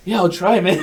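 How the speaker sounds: laughing it out. Retold or imitated. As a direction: say it through laughter. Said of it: "I was like".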